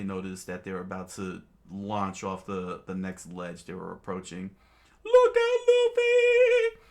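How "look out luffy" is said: very high, loud falsetto; squeaky warning scream. Acted. Sanji warning Luffy of the ledge, in a squeaky scream. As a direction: shout the warning in a tiny voice. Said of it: "he screamed".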